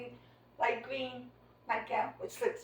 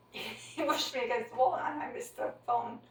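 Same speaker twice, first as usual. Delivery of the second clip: normal talking voice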